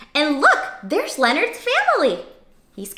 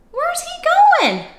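surprised; slower than usual. Acted. Surprised at Leonard leaving.

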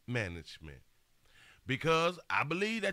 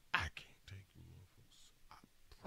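whisper; 10 semitones lower, 15 dB quieter than usual. Real, not acted. Exasperated aside.

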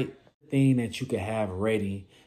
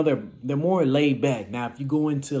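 normal talking voice

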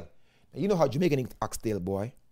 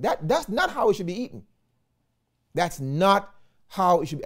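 ordinary talk, nothing marked

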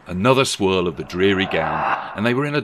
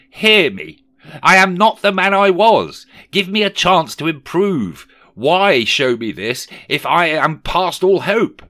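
high, loud impassioned appeal; desperate pleading; 11 semitones higher, 9 dB louder than usual. Acted. Scrooge begging the spirit for a chance, desperate. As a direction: beg at full theatrical pitch.

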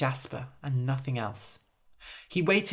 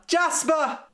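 much higher, louder urgent call; 13 semitones higher, 4 dB louder than usual. Acted. A woman calls for Jasper again, urgent high call. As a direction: call again, more desperate.

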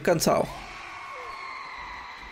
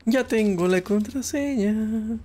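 slow, higher, savoring each word; drawn-out triumph; 7 semitones higher, slower than usual. Pretend. Gamer gloating to himself over the found password, slow and high. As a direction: stretch it out like a little victory. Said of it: "repeats line, first drawn out".

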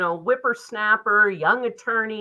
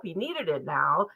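ordinary delivery

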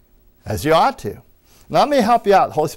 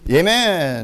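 loud, drawn-out Amen; enthusiastic amen; 3 semitones higher, 5 dB louder than usual. Real, not acted. Preacher rousing the congregation with an enthusiastic amen. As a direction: belt out a long, rousing amen. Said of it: "say amen and say it with enthusiasm".

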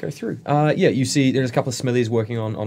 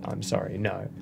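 usual speaking voice

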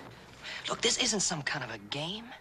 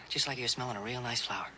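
usual speaking voice

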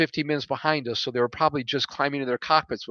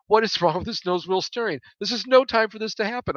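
higher-pitched, exasperated outburst; 8 semitones higher than usual. A narrator's reading. Pilot fuming to himself over failed steering, exasperated. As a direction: vent at the broken equipment, pitch climbing.

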